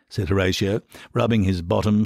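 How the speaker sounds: ordinary talk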